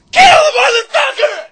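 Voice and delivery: high-pitched full-force scream; yelling. Acted. Librarian yelling at a fighter, full-throated screaming.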